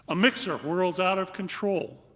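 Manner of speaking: usual speaking voice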